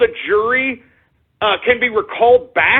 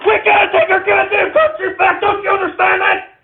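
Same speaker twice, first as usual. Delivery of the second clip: furious shout; 8 semitones higher than usual. Real, not acted. Host yelling at viewers to take the country back, furious shout. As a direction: scream it at people who won't listen. Rant boils over into shouting.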